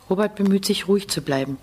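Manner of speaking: ordinary delivery, nothing marked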